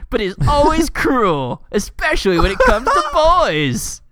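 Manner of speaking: high, laughter breaking into the words; laughing while reading. Real, not acted. Reading a silly character bio to co-host, cracking up. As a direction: struggle to read on through real laughter.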